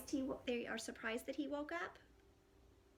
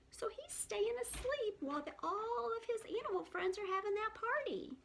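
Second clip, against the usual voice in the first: ordinary delivery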